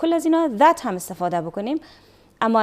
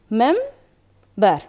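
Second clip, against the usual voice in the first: "مم، بر" as two separate beats with a clear pause; one syllable at a time, "مم" then "بر"; split into syllables; 4 dB louder than usual. Shown as a demonstration. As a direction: leave a gap between "مم" and "بر". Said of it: "من تقسیم کردم و دو هجا".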